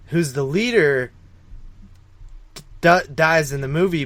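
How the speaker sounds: usual speaking voice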